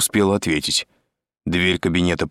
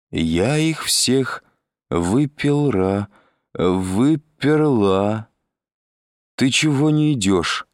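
higher, dragging tipsy voice; drunk Selma; 4 semitones higher, much slower than usual. A narrator's reading. Drunk Selma asks Andrei why he isn't coming, slurry drawl. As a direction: stumble slowly through the words, very drunk. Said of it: "совершенно пьяный голос Сельмы".

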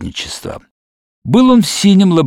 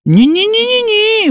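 high protest. Acted.

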